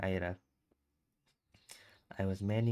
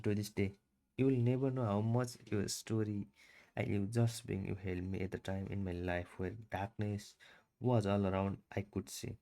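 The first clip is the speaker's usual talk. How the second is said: usual speaking voice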